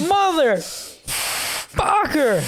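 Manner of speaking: high, drawn-out strained curse; Ash. Acted. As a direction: swear slowly through mock agony. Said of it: "five minutes of Ash is there going like".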